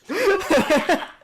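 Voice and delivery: loud high burst of laughter; a sudden high giggle fit. Acted. The judge breaks into a high laugh at the whip.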